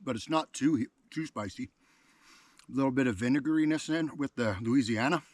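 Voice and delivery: talking with a mouthful of chili; words round a bite of food; mouth full. Real, not acted. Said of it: "I'm gonna talk with my mouth full".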